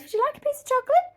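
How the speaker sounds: high, fast mock Sheldon voice; imitating Sheldon. Man offering chocolate to condition her, in a high fast mock voice. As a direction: pipe it up high and quick like Sheldon. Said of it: "he goes".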